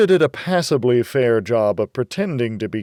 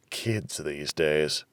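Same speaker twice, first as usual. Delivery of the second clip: ordinary delivery, nothing marked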